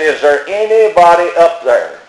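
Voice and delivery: imitating a man calling out for anyone; retold holler. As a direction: call up the cliff, but only half-heartedly. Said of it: "And he hollered".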